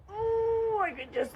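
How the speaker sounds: high, strained groaning "oh"; pent-up frustration. Shown as a demonstration. Speaker acts out the feeling welling up, frustrated groan. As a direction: start high, as if about to burst.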